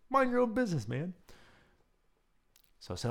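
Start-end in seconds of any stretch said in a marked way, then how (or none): 0.1-1.1 teasing retort. Retold or imitated. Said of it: "you know".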